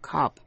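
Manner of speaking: cop with an "aw" or "ah" vowel; open O. Shown as a demonstration. Teacher shows learners cop pronounced with the open vowel. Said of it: "hay là A trong chữ cop".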